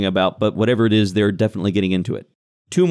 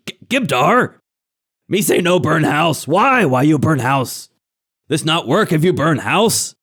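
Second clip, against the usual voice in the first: higher-pitched, exasperated goblin boss; scolding hobgoblin; 5 semitones higher than usual. Acted. Hobgoblin telling off Gibdar for burning the house, exasperated. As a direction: scold an underling in broken goblin speech.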